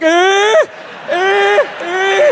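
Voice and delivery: high, loud strained "eh", repeated; goofy high repeated bleat; mock showering noise. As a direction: squeal out short mocking noises. Mimes showering with a loaded gun.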